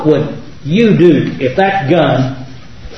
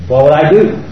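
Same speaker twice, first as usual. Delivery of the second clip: ordinary talk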